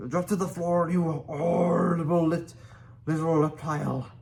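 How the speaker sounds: high, loud angry command; furious yell. A narrator's reading. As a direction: chief roaring at reptile. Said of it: "yelled Stoik".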